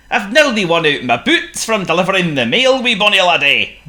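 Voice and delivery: a Scots postman moaning about his worn-out boots; grumbling Scottish accent. Acted. Scottish postman grumbles to the penguin about his worn-out boots, in a Scottish accent. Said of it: "Antarctiville's Scottish postman".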